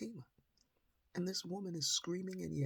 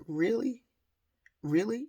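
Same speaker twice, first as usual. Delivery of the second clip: incredulous; 8 semitones higher, 7 dB louder than usual. Retold or imitated. Mother confronting the woman.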